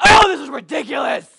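sudden high, loud outburst of impatience; mock angry yell. Impatient shopper made to wait ten seconds, yelling in mock outrage. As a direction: blow up in hammed-up frustration. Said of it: "I'm yelling".

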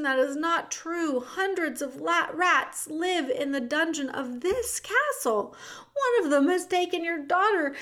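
high, earnest little mouse voice; pleading mouse. Acted. The mouse pleads with the king about the dungeon, high. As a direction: keep pleading over him.

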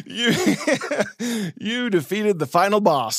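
high-pitched, laughing mock celebration; mock triumph. Cheering a pretend win over the inner accuser, laughing mock triumph. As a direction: giggle through a silly, squeaky victory cheer.

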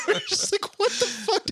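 high, breaking into laughter; laughing disbelief. Real, not acted. Retelling disbelief at officer.

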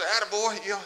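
rally him like a hopeful brother would; hoped-for cheering. Retold or imitated. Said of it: "David was expecting his brother to say".

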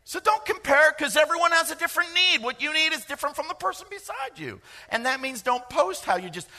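full-force preacher's cry; preaching hard. Real, not acted. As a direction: drive it home to the congregation. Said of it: "preacher drives the point home".

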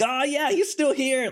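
high, squealy delighted fan voice; mock elated. Fan thrilled Ekko is still around, high squeal. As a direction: squeal it with glee. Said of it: "or like".

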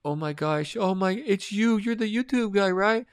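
put-on squeal of recognition; excited fan. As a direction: imitate a starstruck woman in a high, thin voice. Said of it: "she's like".

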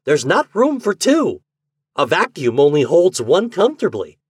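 high, loud, agitated character voice; excited Garvey. A narrator's reading. Garvey explaining Marx to the secretary, loud and agitated. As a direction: insist excitedly, pitch riding high then easing.

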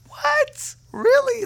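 high, squeaky incredulous "what?"; stunned disbelief. Retold or imitated. Young believer reacting to a healed leg, stunned.

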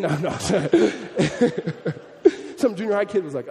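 ordinary delivery

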